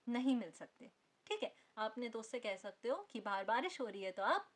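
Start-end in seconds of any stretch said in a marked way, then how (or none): none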